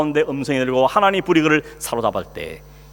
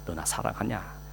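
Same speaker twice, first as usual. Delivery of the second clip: soft question; 4 semitones lower, 11 dB quieter, slower than usual. Acted. Jesus's question repeated softly.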